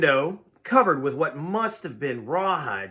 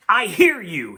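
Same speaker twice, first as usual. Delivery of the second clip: sharp, louder accusation; accusing; 4 semitones higher, 7 dB louder than usual. Acted. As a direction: pounce on him like a lawyer. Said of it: "he charged me like a lawyer in court".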